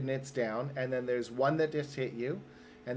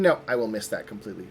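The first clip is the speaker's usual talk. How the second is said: ordinary talk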